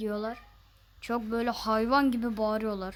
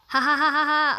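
loud taunting laughter; loud mocking laugh; 7 dB louder than usual. Real, not acted. Gamer taunting an opponent, loud mocking laugh.

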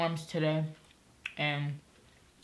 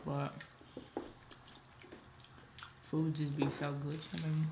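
speaking between bites; talking while chewing salad; 7 dB quieter than usual. Real, not acted. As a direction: mouth partly full of lettuce. Seen 1: Left speaker puts lettuce in mouth at 0.9, then talks chewing.

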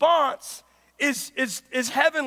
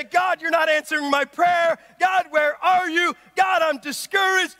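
raised, pleading complaint to God; frustrated crying out; 7 semitones higher, 9 dB louder, slower than usual. Acted. Preacher voicing a discouraged believer praying to God, frustrated crying out. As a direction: call out in mounting exasperation. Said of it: "frustrated and I get discouraged".

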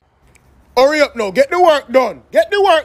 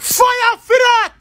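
outraged shout; 7 semitones higher, 3 dB louder, much slower than usual. Acted. Uncle's outraged catchphrase.

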